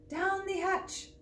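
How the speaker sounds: high, slow drawn-out cheer; crew shout. Acted. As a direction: toast it all together. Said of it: "we all shouted".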